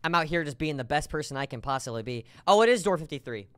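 ordinary talk

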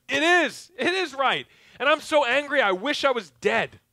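loud, high, furious outburst; angry Jonah. Acted. Preacher voicing Jonah shouting at God, furious. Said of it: "To which Jonah's like".

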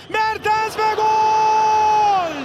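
long drawn-out goal shout; screaming football commentator. Real, not acted. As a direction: stretch out the goal call. Goal call in live commentary.

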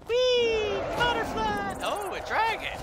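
very high gleeful squeal; excited squeal. Real, not acted. Gleeful at butterfly and dragon.

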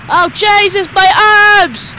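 loud high yelling in alarm; panicked yell. Real, not acted. Gamer yelling as his rider loses arms. Losing limbs in game.

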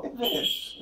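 whispered, simple permission; whispered reply. Retold or imitated. Preacher voicing Jesus granting sight, whispered. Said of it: "και του λέει".

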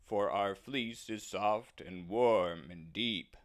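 drawn-out woolly bleat; bleating sheep. Acted. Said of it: "said the sheep".

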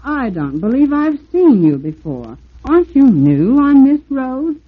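flattering water tower gushing. Acted. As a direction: flatter him breathlessly. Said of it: "gushy way".

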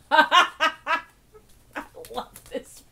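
cracking up mid-sentence; laughing. Real, not acted. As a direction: barely get it out through laughter. Cracks up reading the book.

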